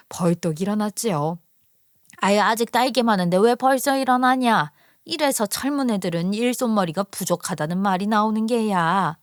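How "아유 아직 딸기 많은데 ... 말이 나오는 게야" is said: put-on nagging older in-law's grumble; grumbling mother-in-law. Mother-in-law complaining at daughter-in-law quitting fieldwork early, grumbling. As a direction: grumble reproachfully at the young one. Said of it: "시어머니가 뒤에서 꿍시렁거리는데".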